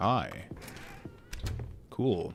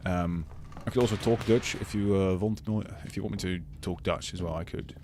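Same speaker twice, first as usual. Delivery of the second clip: English in a Dutch accent. Shown as a demonstration. Streamer showing viewers how a Dutch person talks, Dutch accent. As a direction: impersonate someone from the Netherlands speaking English. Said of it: "very much like this".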